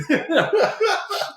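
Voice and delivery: squeaky high-pitched giggle; tiny high laugh in a mock voice. Laugh inside the voiced quote.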